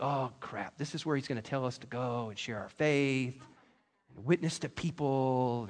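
low, muttered dread; mock dread. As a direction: grumble it like a reluctant churchgoer. Congregation's imagined dread.

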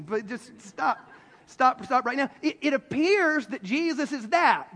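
ordinary talk

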